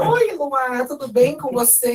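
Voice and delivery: put-on high, loud, bubbly little girl's greeting; little sister voice. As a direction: squeal it like an excited four-year-old on the phone.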